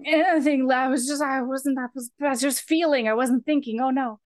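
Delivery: high, stumbling mock apology; flustered backpedalling. Retold flustered apology after being corrected.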